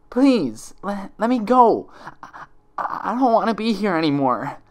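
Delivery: high, loud, pleading through sobs; tearful begging. Acted. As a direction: beg to be let go, voice cracking with tears. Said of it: "she begged".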